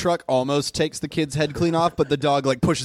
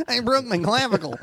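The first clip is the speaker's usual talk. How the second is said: high mock-pained child; hurt kid; 7 semitones higher than usual. Kid reporting broken bone to dog, mock pain. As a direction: announce the injury in a high kid voice.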